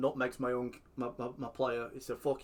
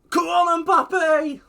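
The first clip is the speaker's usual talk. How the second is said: much higher, much louder ecstatic shout; goal scream; 16 dB louder, much slower than usual. Real, not acted. Cheering his striker's chance, full scream. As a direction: scream it as the ball goes in.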